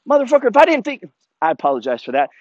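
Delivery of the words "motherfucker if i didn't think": high-pitched, heated comeback; exasperated, raised-pitch rebuke; indignant retort. Retold or imitated. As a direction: fire back at a cocky challenger. Acted retort to a kid's jibe.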